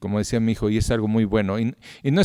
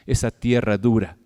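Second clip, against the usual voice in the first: usual speaking voice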